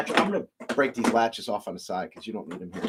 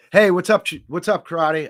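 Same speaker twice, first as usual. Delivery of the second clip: loud greeting; 6 semitones higher, 7 dB louder than usual. Real, not acted. Greeting a viewer joining the stream.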